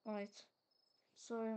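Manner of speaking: ordinary talk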